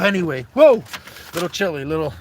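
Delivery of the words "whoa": sudden high, loud whoa; startled whoa. Real, not acted. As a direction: caught off guard, loud and high. Phone wobbling again.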